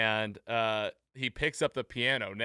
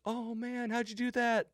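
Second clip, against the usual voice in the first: very high, hammed-up wonder; imitating impressed onlookers; mock amazed crowd; 11 semitones higher than usual. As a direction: coo like a dazzled audience. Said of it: "everybody's like".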